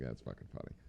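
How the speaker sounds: low breathy whisper, no voice; whispered aside. Retold or imitated. Mocking the cops in a hushed whisper. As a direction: whisper it like a sneaky joke. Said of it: "They're like".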